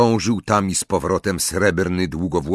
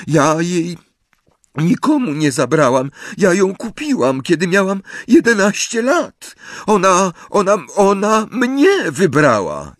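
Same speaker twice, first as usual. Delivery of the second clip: high, loud tearful wail; sobbing protest; 11 semitones higher, 5 dB louder than usual. A narrator's reading. Accused witch defends her wand, sobbing high and loud. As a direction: plead through tears, halting and rising. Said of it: "zaszlochała jeszcze głośniej".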